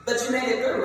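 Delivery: ordinary talk, nothing marked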